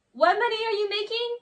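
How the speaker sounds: high-pitched, exasperated demand; incredulous challenge. Real, not acted. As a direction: throw the question out in disbelief. Ranting at the absent singer.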